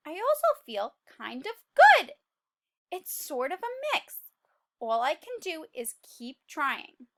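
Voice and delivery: lively, upbeat read-aloud; cheerful narration. A narrator's reading. Seed listing his good habits to the class, cheerful narration. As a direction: read it bright and hopeful.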